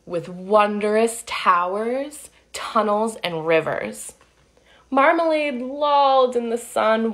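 put-on lively read-aloud for kids; storybook narration. A narrator's reading. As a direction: tell it like a picture book to children. Reading picture book aloud.

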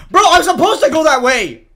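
high, very loud protest; outraged shout. Real, not acted. Gamer arguing with the map, outraged shout. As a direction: yell it in one indignant burst.

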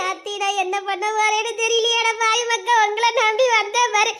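ordinary talk

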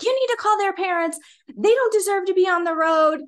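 loud, high mock scolding; cussing out. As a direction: imitate an irate neighbour insisting hard. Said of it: "basically cussing me out".

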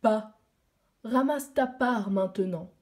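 usual speaking voice

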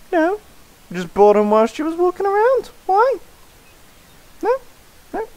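high, squeaky goofy man. Acted. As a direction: squeak it out like a dopey merchant. Voicing fat guy character.

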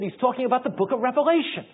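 a wife who won't take no; insistent wife. Retold or imitated. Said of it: "She said".

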